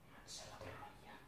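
cursing under her breath; whispered, annoyed swear; whispered curse. Real, not acted. Cursing at broken item, under breath.

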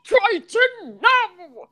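high, slow mock Irish command; Jason. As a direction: order it in a bad, stretched Irish accent. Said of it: "I'm doing an impression of you".

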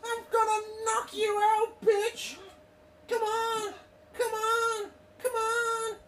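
very high falsetto taunts; squeaky mock threats. Threatening a knockout in play, high squeaky mock bravado. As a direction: goad them in a silly squeal.

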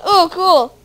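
ordinary talk, nothing marked

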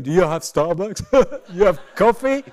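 loud, patronising mock question; mock insulting question. Speaker mimics a visitor insulting the Welsh, loud mock condescension. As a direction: ask it loudly as if to someone clueless. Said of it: "this is not a Welsh insult. I'm not going".

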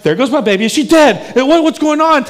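put-on fear climbing as the baby is rushed off; frightened outburst. New father asking if his baby is dead, frantic. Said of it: "I'm like".

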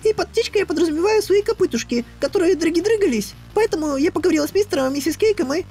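very high, fast bubbly mare; talking pony. Acted. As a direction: rattle on, giddy and breathless. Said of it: "она, похоже, не умолкнет".

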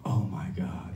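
low, whispered imitation of a stunned man; mock awestruck whisper; hushed shock. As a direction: breathe it out, barely voiced. Acted reaction to overheard sound.

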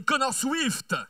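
a slow, lifted rider name; announcer name call. Real, not acted. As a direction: present him to the crowd. Announcer presents rider to crowd.

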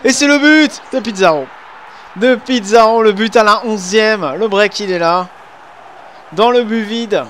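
high, loud goal call; excited sports commentary. Pretend. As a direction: belt it out like a TV commentator. Second goal scored in game.

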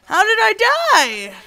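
high, loud stunned cry; shocked outburst. Real, not acted. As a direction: yell in disbelief at dying. Sudden death in game.

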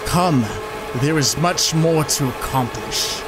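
mock celebrity chef giving orders; Chef Ramsay voice. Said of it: "I'm not even doing the Ramsay voice anymore".

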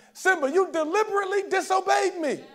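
Mufasa scolding. Retold or imitated. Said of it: "it's the voice of James Earl Jones".

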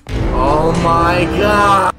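loud, drawn-out groaning shout; exasperated yell. Real, not acted. Player fighting the controls, exasperated yell. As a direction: stretch it out in loud frustration.

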